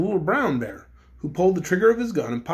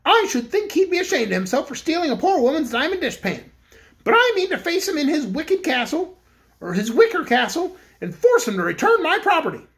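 higher-pitched indignant Cook voice; Cake the Cookie Cook; 10 semitones higher than usual. A narrator's reading. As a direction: voice Cake high and set on getting her dishpan back.

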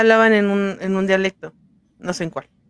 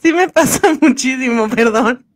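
embarrassed laugh; 2 semitones higher than usual. Real, not acted.

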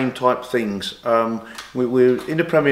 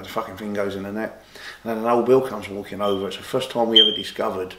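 ordinary talk

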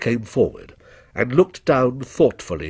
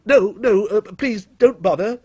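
higher, hurried protest; flustered cry; 8 semitones higher than usual. A narrator's reading. Customer forestalling another testimony, flustered. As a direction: cut in with a higher-pitched plea. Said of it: "cried Timothy".

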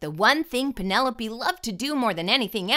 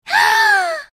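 loud high gasp; shocked gasp; 11 dB louder than usual. A narrator's reading. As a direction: gasp in horror.

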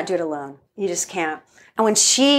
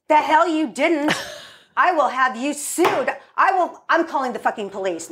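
angry mom; 6 semitones higher than usual. Retold or imitated. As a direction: threaten the valet furiously. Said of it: "And she goes".

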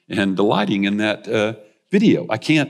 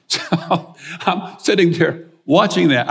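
laughing; 8 semitones higher, 3 dB louder, slower than usual. Real, not acted. Laughs while retelling his delight.

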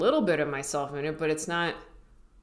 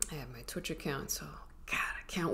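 whisper; 11 dB quieter, faster than usual. Real, not acted. Excited whisper to viewers.

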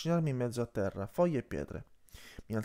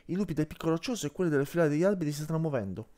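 fast, urgent report; hurried; 4 semitones higher, 3 dB louder, faster than usual. Acted. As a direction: rush the words out, there's no time. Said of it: "disse in fretta Marra".

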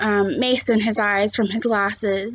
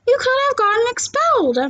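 put-on high young girl's voice, alarmed; worried girl scolding a friend, pitched high; Kayla's voice; 11 semitones higher, faster than usual. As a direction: warn him in a much higher girlish voice.

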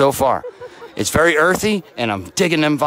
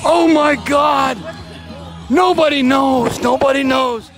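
excited shout; 11 semitones higher, slower than usual. Real, not acted. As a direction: yell it to the whole arena in disbelief. Nobody knows what SWF means.

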